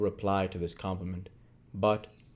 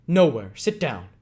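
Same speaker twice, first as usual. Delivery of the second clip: louder, higher command to sit; loud order; 4 semitones higher, 7 dB louder than usual. Acted. Sikes forbidding Nancy to leave, loud command. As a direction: stop her with a barked order.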